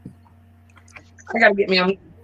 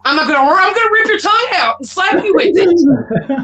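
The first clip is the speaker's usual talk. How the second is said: higher-pitched, playful threatening rant; mock threat; 6 semitones higher than usual. Speaker imagines ranting into the microphone, mock threat. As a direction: threaten them as a hammed-up joke.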